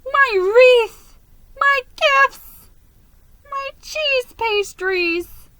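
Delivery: high, wailing sobs over each lost thing; grieving each treasure with a broken cry; wailing lament, slow on the last; sobbing. A narrator's reading. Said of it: "sat down on the curb and sobbed".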